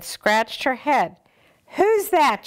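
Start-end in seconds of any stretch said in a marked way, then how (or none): 1.8-2.5 high puzzled question; librarian voice. Acted. The librarian wonders who is there, voice raised high. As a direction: ask it high and baffled. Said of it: "she asked".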